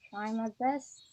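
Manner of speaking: usual speaking voice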